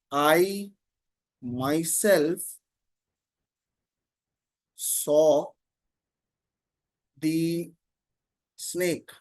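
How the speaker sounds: slow, with long gaps between words; measured, one word at a time; word-by-word dictation. Shown as a demonstration. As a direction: dictate it for someone writing it down. Dictating an example while writing it.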